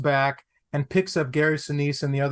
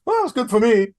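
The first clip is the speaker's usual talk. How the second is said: much higher and louder dry brush-off; dismissive director; 8 semitones higher, 5 dB louder than usual. Retold or imitated. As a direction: shrug him off. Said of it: "De Palma says".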